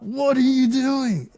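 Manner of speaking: high, drawn-out yell of disbelief; mock-panicked high holler; exasperated cry. Acting out exasperation at stopping cars.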